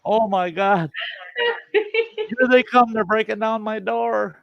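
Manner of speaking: hammed-up dread at the door; being chased. Mock panic, they're coming.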